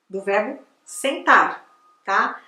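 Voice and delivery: ordinary talk, nothing marked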